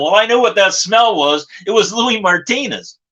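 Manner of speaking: plain reading voice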